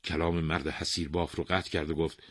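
usual speaking voice

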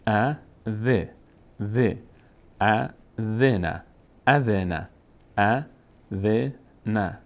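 "ذ" as a short dhe, syllables slow and separate; short e on kasra. Shown as a demonstration. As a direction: spell out "ا، ذ نه" slowly with a short e. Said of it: "صدای ا". (شمرده، ذِ با کسرهٔ کوتاه)

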